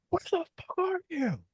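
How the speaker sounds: squeaky-high mock rage; mock yelling. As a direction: imitate a teammate screaming at you. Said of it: "yelling at me".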